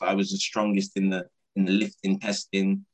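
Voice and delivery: ordinary talk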